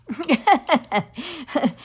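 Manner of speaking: quick amused chuckle; a short real laugh; light laugh. Real, not acted. Laughs at own fumbling.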